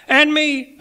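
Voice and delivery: very high, loud piping voice; relation chiming in. A narrator's reading. Poor relation asks to join toast, high piping interjection. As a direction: pipe up from down the table.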